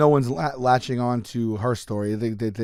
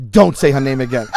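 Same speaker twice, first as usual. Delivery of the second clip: firm warning; 7 dB louder than usual. Retold or imitated. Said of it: "he goes".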